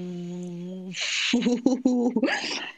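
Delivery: long droning hum into the mic; didgeridoo hum. Pretend. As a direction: drone it out like a didgeridoo. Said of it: "you just hummed into the microphone".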